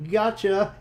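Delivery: spring the joke on them; triumphant gotcha. Acted. John revealing his prank to his friend, triumphant. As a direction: pop it out high and loud, delighted with the prank.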